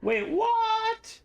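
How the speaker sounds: a sudden high squeak of confusion; startled high wait what; surprised. Real, not acted. Sudden confusion mid-chat.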